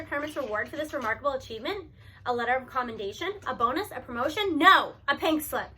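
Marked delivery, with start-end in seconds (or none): 4.6-5.7 slow and loud, pink slip hammered; indignant reveal of the firing; punchline. A narrator's reading. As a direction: land the punchline slowly. Punchline of the article.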